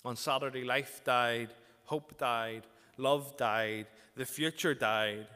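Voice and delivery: loud, ringing preacher's cry; full-force preaching. Acted. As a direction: preach it out, building despair. Preaching about Saturday's despair.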